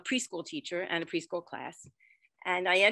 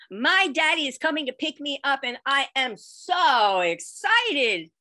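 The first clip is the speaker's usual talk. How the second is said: higher, louder and slow, thrilled; mock child bursting with joy; excited child; 7 semitones higher, 7 dB louder, slower than usual. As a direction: gush like an excited little boy. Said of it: "He was saying".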